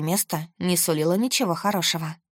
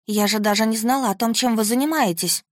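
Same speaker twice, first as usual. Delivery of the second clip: higher, louder, desperate denial; frightened protest; 4 semitones higher, 3 dB louder than usual. Acted. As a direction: defend yourself in panic.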